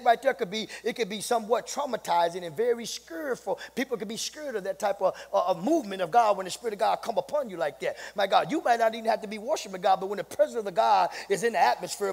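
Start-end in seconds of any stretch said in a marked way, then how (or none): none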